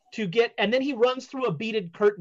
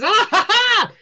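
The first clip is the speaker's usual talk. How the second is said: loud, much higher stagey laugh; theatrical seer laugh; 11 semitones higher, 7 dB louder than usual. Acted. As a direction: greet them with a showman's cackle. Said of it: "he says".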